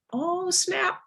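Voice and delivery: high-pitched retold "oh snap"; stunned realisation. Daughter reacts to her mother's retort, mock stunned. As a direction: jump up high, mock shock.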